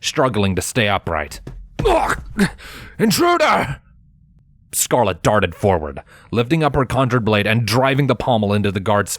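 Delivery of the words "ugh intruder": much higher grunt then alarm call; grunt, then cry out the alarm. A narrator's reading. Downed guard calling the alarm, much higher.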